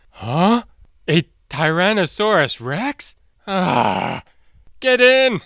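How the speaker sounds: high surprised, then a loud urgent call; startled then urgent. Acted. Third brother sees T-Rex and shouts them inside. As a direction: spot the danger and hurry them in.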